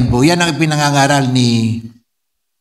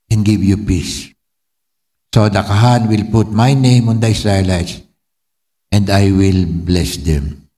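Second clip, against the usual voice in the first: solemn benediction; 3 semitones lower than usual. Acted. Preacher blessing the congregation at the close, solemn.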